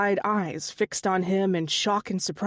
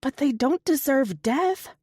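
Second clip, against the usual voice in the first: higher, tight pleading argument; 6 semitones higher than usual. A narrator's reading. Katie arguing with Otto, higher and strained. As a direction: push back hard, voice strained. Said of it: "her voice tight".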